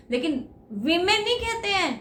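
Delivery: ordinary talk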